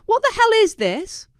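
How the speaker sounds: high, loud angry yell; furious yell. A narrator's reading. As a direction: scream it down the phone. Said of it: "Paisley's voice was so loud".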